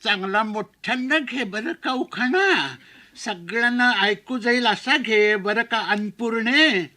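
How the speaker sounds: put-on slow, coaxing old aunt; drawn-out fussy auntie urging the bride. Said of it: "आणि म्हणाली".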